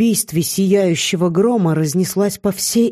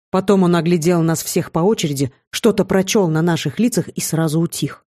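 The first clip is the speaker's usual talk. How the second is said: usual speaking voice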